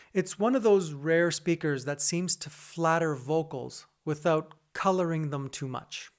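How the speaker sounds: ordinary talk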